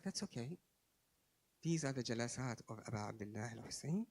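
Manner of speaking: soft, settled reassurance; quiet, unhurried comfort; calm. Retold or imitated. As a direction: say it with complete trust. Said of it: "Very calm".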